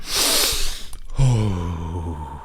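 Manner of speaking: long, falling exhale; long sigh. Real, not acted. Dropping another conversation, long sigh. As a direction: sigh it out slowly.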